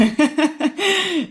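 high laugh. Real, not acted. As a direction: burst of high-pitched laughter. Host laughs at twins joke.

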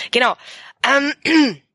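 cold-hoarse voice breaking up; voice giving out. Real, not acted. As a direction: voice cracking and failing. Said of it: "meine Stimme versagt".

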